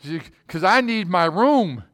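imitating an excited, emphatic worshipper. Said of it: "You're really excited about this".

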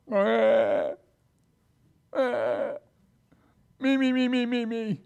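put-on high, babyish me-me-me; high mocking singsong of a spoiled child; mocking whine. Mocking whine after the complaint.

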